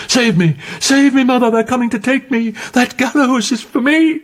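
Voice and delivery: high, desperate cries for help; anguished plea. A narrator's reading. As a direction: beg her mother in terror. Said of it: "in anguish".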